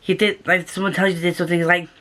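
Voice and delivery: usual speaking voice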